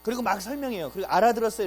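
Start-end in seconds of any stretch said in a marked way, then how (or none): 1.1-1.6 mock guide check. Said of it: "이런 거 체크 못 해요".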